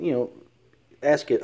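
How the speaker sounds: usual speaking voice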